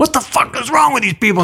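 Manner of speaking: high-pitched exasperated busboy rant; imitated angry yelling. Busboy ranting about tourists, mock yelling.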